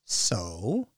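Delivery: understated, would-be casual question; trying to sound nonchalant. A narrator's reading. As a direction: act as if it doesn't matter. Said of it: "Steve tried to sound nonchalant".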